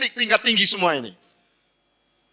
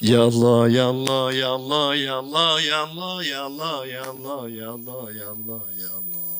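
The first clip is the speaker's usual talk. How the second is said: fading dhikr; 9 semitones lower than usual. Shown as a demonstration. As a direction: chant it, letting it fade away. (zikir berulang yang makin pelan)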